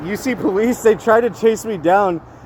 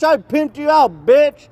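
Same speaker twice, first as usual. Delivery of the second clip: slow, high-pitched mock taunt; acted taunt; 9 semitones higher, slower than usual. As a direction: drag it out like a heckler gloating.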